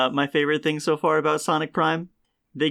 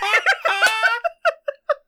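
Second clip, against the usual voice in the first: helpless high laughter; 4 dB louder than usual. Real, not acted. Cracking up at the story.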